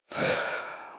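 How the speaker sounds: long voiceless sigh. Real, not acted. Speaker recalls basic training, heavy sigh. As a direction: let the breath out before starting.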